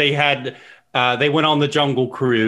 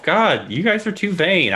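ordinary delivery

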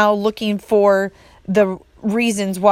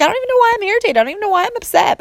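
much higher-pitched, exasperated imitation; mock irritation; 13 semitones higher, much faster than usual. Client unsure why everything bothers her, mock irritation. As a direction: act out baffled irritation in a very high voice. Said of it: "everything is irritating".

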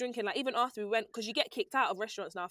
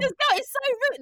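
high laughing mock outrage; laughing at a friend's cheeky post, high and loud; 14 semitones higher, 6 dB louder than usual. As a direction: squeal it through laughter, mock offended. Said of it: "I was like".

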